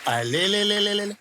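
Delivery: put-on drawn-out cooing at a baby. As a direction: coo slowly like a doting uncle. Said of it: "uncle प्यार कर रहे".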